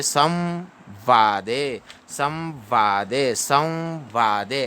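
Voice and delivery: slow "संवादे" with lips closing into a held half v; correct reading. Shown as a demonstration. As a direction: draw out "संवादे" as saw-vaa-de. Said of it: "ऐसे पढ़ना है".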